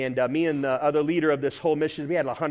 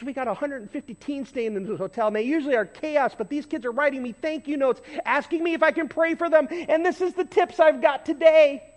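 cleaning lady speaking; 10 semitones higher, 3 dB louder than usual. Retold or imitated. Preacher voicing the grateful cleaning lady, higher and building louder. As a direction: lift it up high and build with joy. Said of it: "She goes".

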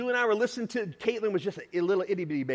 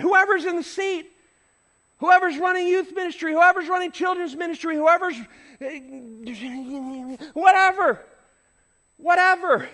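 loud, high preaching climax; fervent preaching; 10 semitones higher, 7 dB louder than usual. Acted. Pastor urging the congregation, fervent preaching. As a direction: drive it home from the pulpit.

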